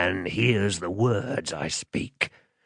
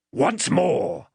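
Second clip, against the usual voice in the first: higher, threatening demand; menacing man; 9 semitones higher than usual. Acted. Man demanding the child's name, menacing. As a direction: play a rough interrogator bullying a child.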